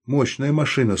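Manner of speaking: ordinary delivery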